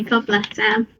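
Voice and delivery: ordinary delivery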